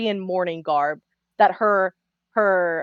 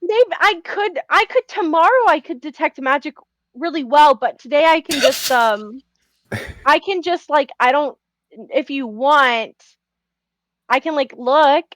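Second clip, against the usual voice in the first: high, flustered false starts; stammering; 5 semitones higher than usual. Acted. Character offering to look for magic, stammering. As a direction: stammer through an offer, pitched up and unsure. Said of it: "as you're stammering".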